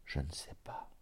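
whispered, barely voiced admission; murmur. A narrator's reading. Monk answering the traveller's question, murmured in a whisper. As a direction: whisper it very softly, low. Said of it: "Il murmura".

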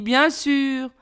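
drawn-out sigh of doubtful agreement; mildly exasperated, sighing concession. A narrator's reading. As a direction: humour him with a sigh. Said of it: "soupira Touilly".

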